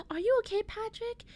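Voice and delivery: high, anxious check-in; worried rescuer. Retold or imitated. Alex and Simon find Patrick guarding the kids, high and worried. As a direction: call out to him with sudden concern. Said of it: "they're like".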